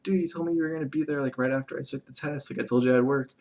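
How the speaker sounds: high-pitched, hurried mock complaint; retold near-yell. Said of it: "like, yell at him".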